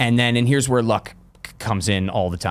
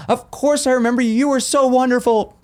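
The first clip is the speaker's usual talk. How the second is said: high, gushing sweetness; gushing sweet lady; 13 semitones higher than usual. Retold or imitated. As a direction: gush over him warmly. Actress greets him warmly.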